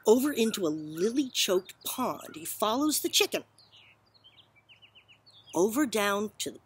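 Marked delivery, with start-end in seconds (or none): none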